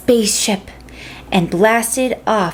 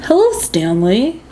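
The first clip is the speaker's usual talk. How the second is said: little brother voice. A narrator's reading. Will greets the Martian.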